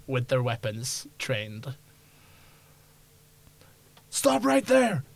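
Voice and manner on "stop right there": high, loud command to halt; guard's shouted order. Acted. Guards order fleeing players to halt.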